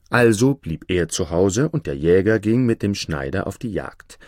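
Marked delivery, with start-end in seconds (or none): none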